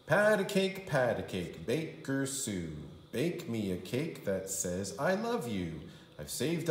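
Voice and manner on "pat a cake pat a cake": usual speaking voice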